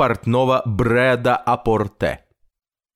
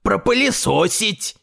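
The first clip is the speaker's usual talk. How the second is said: higher put-on voice calling out; mild cry; 7 semitones higher than usual. A narrator's reading. Story character gives an order, mild cry. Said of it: "крикнул он".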